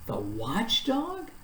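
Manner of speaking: slow, rising, puzzled question; quizzical. A narrator's reading. Milo asks about the watchdog, slow and puzzled. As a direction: ask it slowly, baffled. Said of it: "said Milo quizzically".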